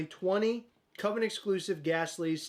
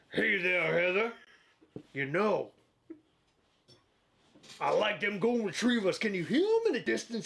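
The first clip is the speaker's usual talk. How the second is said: Huck voice; 2 semitones higher than usual. Acted. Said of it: "let me show you how the pros do it".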